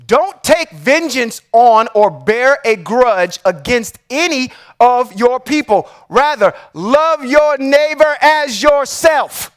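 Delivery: slow, deliberate reading, each word weighed; read slowly. Shown as a demonstration. Reading a verse he calls Christ's words, slow and deliberate. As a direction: lay out every word slowly and carefully. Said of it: "I'm gonna read this slow".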